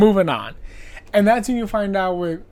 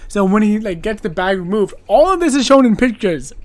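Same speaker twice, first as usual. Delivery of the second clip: ordinary delivery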